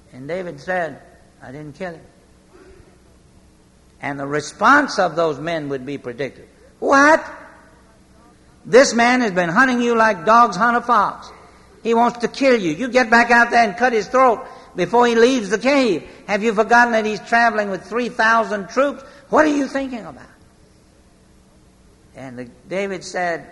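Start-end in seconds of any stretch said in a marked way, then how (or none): 1.4-2.0 much softer, low and steady; quiet calm. Retold or imitated. David to his eager men, quiet and firm. 6.8-20.2 outraged soldiers. Retold or imitated. David's men scolding him for sparing Saul, outraged.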